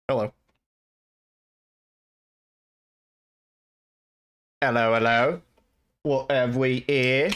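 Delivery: slow, hammed-up bad British accent. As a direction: drawl out a deliberately terrible British accent. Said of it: "the worst British accent I've ever done".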